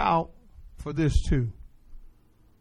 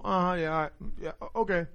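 offhand, much softer, shrugging uh-huhs; casual tone; 3 semitones lower, 13 dB quieter, faster than usual. Shown as a demonstration. Preacher demonstrating a casual tone to the congregation, offhand and soft. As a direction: toss it off like you barely care. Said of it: "You can just be ca-, casual".

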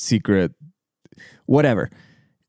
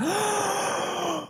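shocked gasp; 8 dB quieter than usual. Retold or imitated. Mock shocked crowd gasp.

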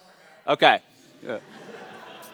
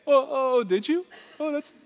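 high-pitched, eager talking dog; dubbed dog voice; 10 semitones higher than usual. Acted. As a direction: play a hopeful dog, high and halting. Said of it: "He's just doing the voice".

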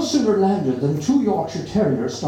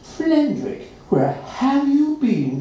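higher, slow affectionate question; the owner welcomes her cat home, higher; fond owner; 10 semitones higher, slower than usual. A narrator's reading. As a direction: greet a lost pet. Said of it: "she says".

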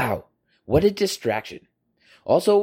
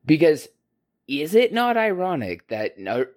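ordinary talk, nothing marked

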